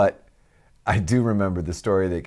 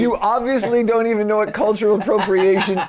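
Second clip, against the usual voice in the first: indignant; 11 semitones higher, 4 dB louder than usual. Acted. Heated mock-indignant argument.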